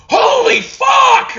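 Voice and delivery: very high, slow, loud shriek of disbelief; hammed-up investor yelling in shock; shocked shout. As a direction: scream it like an investor floored by the markup. Shark investor hearing the markup.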